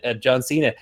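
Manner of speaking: ordinary talk, nothing marked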